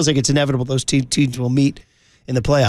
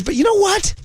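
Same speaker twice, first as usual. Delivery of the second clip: excited. Real, not acted. Building to Warriors segment.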